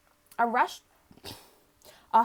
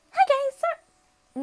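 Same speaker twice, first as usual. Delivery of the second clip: very high, drawn-out character voice; squeaky-high, loud greeting; Miriam; 5 dB louder, much slower than usual. Acted. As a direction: pitch it way up and stretch it out. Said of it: "he can't sound like Miriam".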